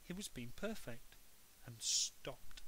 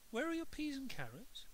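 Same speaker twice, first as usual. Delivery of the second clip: puzzled mum; 11 semitones higher than usual. A narrator's reading.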